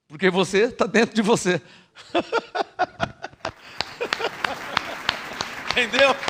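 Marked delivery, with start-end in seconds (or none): 3.8-5.7 soft breathy laugh. Real, not acted. Preacher laughs at own paradox.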